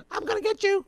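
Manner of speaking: squeaky high mock-tough threat; mock menacing threat. Preacher mocking the TV villain, squeaky high threat. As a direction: lampoon the actor's tough-guy line in a high voice. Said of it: "he's like".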